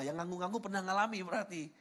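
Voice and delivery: normal talking voice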